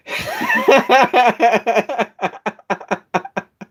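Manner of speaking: long high-pitched burst of laughter; drawn-out high laughing fit; hearty laugh. Real, not acted. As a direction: laugh helplessly in a long run, high above your voice. Amused by own Australian banter.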